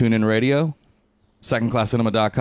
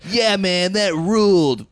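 pumped-up dude voice; 12 semitones higher, 4 dB louder, slower than usual. Retold or imitated. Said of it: "And they're like".

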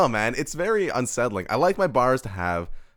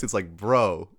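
ordinary talk, nothing marked

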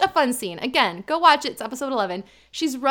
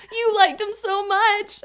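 slow, high mock whine; imitating a drunk's tantrum, high-pitched; drunken tantrum; 9 semitones higher, slower than usual. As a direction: draw it out like a pleading child. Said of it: "like, a tantrum".

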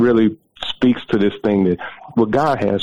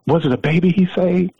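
retold amazed question, pitched way up; high, rising note of wonder; astonished question; 11 semitones higher than usual. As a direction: imitate a woman daring to believe it. Retold wife realising who calls.

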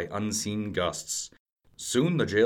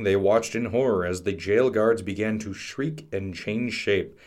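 ordinary delivery, nothing marked

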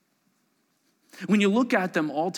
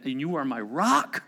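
normal talking voice

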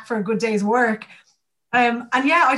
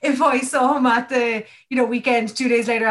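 ordinary delivery

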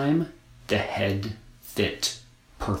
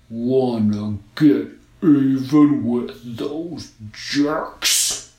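slow, loud sly snowman; 2 semitones higher, 5 dB louder, much slower than usual. A narrator's reading. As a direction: tempt her as a sly snowman. Snowman tempts girl.

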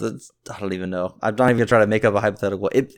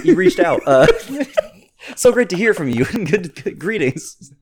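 high, sugary fake-nice reply; a hint of fake corporate cheer; mock polite email voice; 7 semitones higher than usual. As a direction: read it like an overly polite email.

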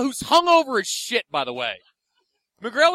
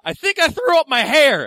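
put-on drunk yell; 6 semitones higher, 7 dB louder than usual. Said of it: "he just yelled".

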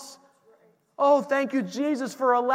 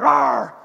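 mock angry roar; 4 semitones higher, 9 dB louder than usual. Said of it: "they're so frustrated".

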